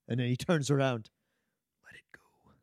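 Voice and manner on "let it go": barely audible tender whisper; calm. Retold or imitated. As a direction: whisper it softly, urging him to let go.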